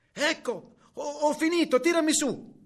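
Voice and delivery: put-on high, loud child's call. A narrator's reading. Girl calling to grandmother to pull her up, loud high call. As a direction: call up loudly from far below.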